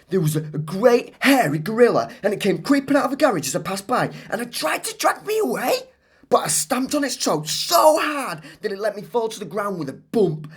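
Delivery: put-on high, excited child telling a wild story; Claire's tall tale. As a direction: spin the tale breathlessly.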